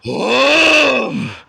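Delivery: high, loud, slow exclamations; stunned. Real, not acted. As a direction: reel from the hit, loud and drawn out. Reacting to strong weed.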